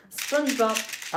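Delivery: hammed-up spoken-word SpongeBob; dramatic poetry read. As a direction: declaim SpongeBob slowly like a poet. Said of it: "I'm doing live poetry".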